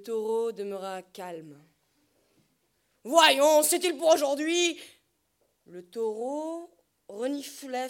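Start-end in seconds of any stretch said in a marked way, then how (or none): 3.0-4.8 impatience criée. Acted. Berger s'impatiente contre le taureau.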